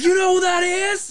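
high, starstruck excited question; excited friend. Retold or imitated. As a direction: gush like a fanboy. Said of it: "He's like".

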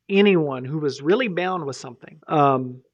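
ordinary talk

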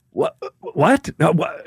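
high, startled stammer of disbelief; a stunned guy blurting what; mock shocked confusion; shocked surfer. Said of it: "the shock in his face".

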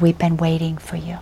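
whispered in awe; hushed whisper. Retold or imitated. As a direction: breathe it like a secret. Villagers quoted as awed, hushed.

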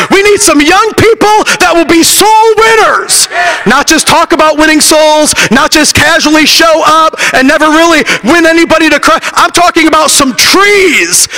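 put-on rally the young people from the pulpit; preaching at full force. Preacher exhorting youth.

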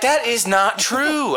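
high, slow protesting retort; indignant denial. Retold or imitated. Answering the man about pooping, slow high denial. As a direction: deny it slowly, pitched high. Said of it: "I'm like".

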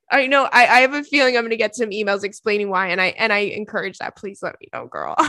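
usual speaking voice

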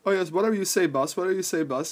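lightly voiced yes man to the boss; a touch of servile eagerness. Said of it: "fucking yes man that say".

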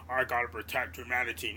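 deep, far-off noble robot leader voice; lofty Optimus Prime. Retold or imitated. Mocking Optimus's old heroic self in a deep voice. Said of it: "he was so".

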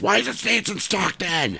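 high mock yell at store staff; hammed-up angry customer; yelling at Walmart associates, mock rage; retold yell. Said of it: "I'm yelling at associates".

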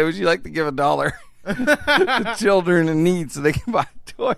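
repeating the cashier's question while cracking up; laughing through it. Retold or imitated. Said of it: "I said".

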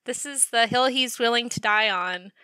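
ordinary delivery

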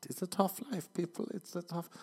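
high-pitched mock whimper; mock self-pity. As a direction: plead for sympathy in jest. Mock complaint about own job.

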